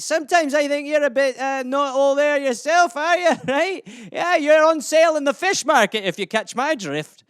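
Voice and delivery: hammed-up Scottish lilt; Scottish accent. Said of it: "some Scottish euphemisms for you".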